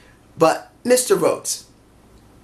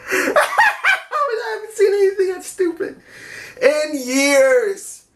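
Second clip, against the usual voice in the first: words pushed out through real laughter; can't stop laughing while speaking; 11 semitones higher, slower than usual. Real, not acted. Fan recounting a silly wrestling bit, laughing.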